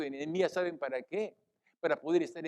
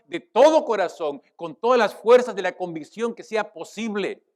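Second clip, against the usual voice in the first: raised, louder exhortation with punched words; fervent preaching; 4 semitones higher, 8 dB louder than usual. Real, not acted. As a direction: urge the congregation with full conviction. Preaches at full force. (exhortación ferviente de predicador)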